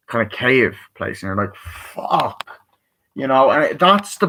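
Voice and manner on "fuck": whispered, drawn-out amazement; awestruck whisper. Retold or imitated. Reviewer voicing characters seeing a cave, whispered awe. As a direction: breathe it out in mock awe.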